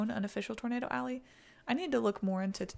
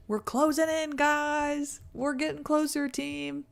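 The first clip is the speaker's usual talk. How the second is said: higher and louder rallying call; excited hype; 7 semitones higher, 4 dB louder, slower than usual. Real, not acted. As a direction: pump up the team. Hyping viewers toward donation goal.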